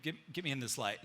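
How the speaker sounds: usual speaking voice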